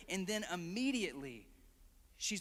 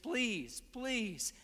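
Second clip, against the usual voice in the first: begging; 4 semitones higher than usual. Retold or imitated. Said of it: "she's begging and begging".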